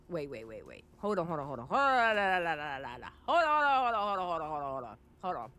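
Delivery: rapid-fire repetition, words running together; frantic hold-on. Real, not acted. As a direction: stammer a string of hold-ons, bursting up then sinking low. Halting the video in disbelief.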